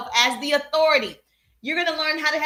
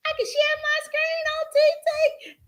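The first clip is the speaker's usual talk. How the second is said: very high, giddy squeal; playful squeal. Pretend. Host showing off screen sharing to viewers, playful squeal. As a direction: squeal it out in delight. Excited share of new screen feature.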